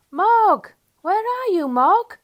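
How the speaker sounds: loud, high call into the dark. A narrator's reading. Children calling for Mog in the rainy dark, shouting. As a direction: call across the garden. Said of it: "They shouted".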